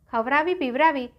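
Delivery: ordinary delivery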